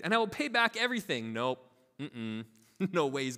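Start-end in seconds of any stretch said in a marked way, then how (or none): 0.0-1.3 mildly pleading, pitched higher; begging. A narrator's reading. Servant begs his master for patience, mildly pleading. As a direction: plead a little as you read it. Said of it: "he begged".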